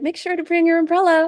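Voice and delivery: high, quick cheery assistant; chirpy AI voice. Retold or imitated. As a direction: chirp the reminder. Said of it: "you know, like".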